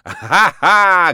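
loud forced stage laugh; shrill shouted laugh. A narrator's reading. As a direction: laugh over a roaring crowd. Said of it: "крикнул он, стараясь перекричать рёв".

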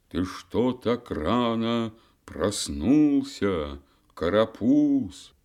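slow, gentle elder voice; an old sage teasing a child; a hint of an old man.

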